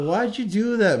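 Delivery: high, loud retold question; imitating an exasperated outburst; exasperated yell. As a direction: cry it out in disbelief.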